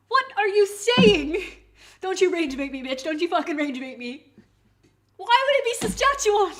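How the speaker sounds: loud, high indignant yelling; exasperated outburst. Real, not acted. Streamer yelling at a rage-baiting viewer, exasperated outburst. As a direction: explode at being baited, voice shooting up.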